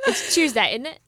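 hammed-up British accent, punched up; mock British accent. Friend shows off to the studio, mock British accent. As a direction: lean hard into a pretend British accent. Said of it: "I'm British all the way".

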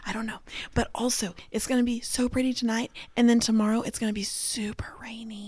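soft, half-whispered aside; whispering. Real, not acted. Wife telling her husband about tomorrow's rain, whispering. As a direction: keep it hushed, as if behind a hand. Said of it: "I'm whispering with my hand over".

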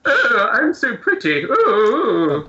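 high, swooning posh puppet voice; really posh puppet. Acted. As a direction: coo like a vain posh puppet. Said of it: "like, a really posh".

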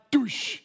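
drawn-out whooshing thud noise; imitated crash. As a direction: voice the sound of a fall. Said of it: "I hear this loud".

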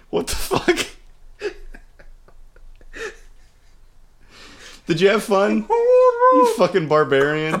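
mock outrage through tears of laughter; laugh-crying. Real, not acted. Teasing Doug after the stare-down, laugh-crying. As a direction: sob out the insult while cracking up.

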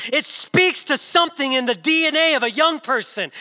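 high, fervent sermon delivery; impassioned preaching. Real, not acted. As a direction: preach it with conviction, stretching key words. Preaches forcefully.

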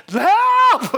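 loud, high yell; demonstrated cry for help; hollering for help. Shown as a demonstration. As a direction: burst out with one big holler. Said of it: "holler for help".

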